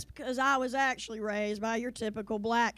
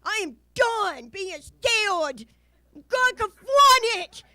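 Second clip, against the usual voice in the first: loud, high, worked-up declaration; fed up; 6 semitones higher, 9 dB louder, slower than usual. Acted. Kid fed up with fear of ghosts, defiant shout. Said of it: "fed up".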